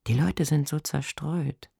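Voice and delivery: usual speaking voice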